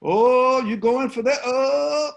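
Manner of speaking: high, loud whooping egging on; taunting kids. Retold or imitated. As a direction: hype up the fight like a crowd. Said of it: "I could hear the kids on the corner say".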